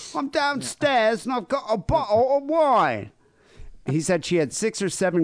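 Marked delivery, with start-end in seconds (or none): 0.0-3.1 high put-on chav girl voice. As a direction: squeal it like a cheeky chav girl. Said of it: "like, a girl Chab voice".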